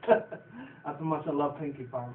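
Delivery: ordinary talk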